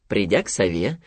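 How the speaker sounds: ordinary delivery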